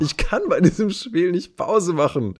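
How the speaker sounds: a gamer who just can't stop; addicted friend. Retold or imitated. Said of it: "meinte er".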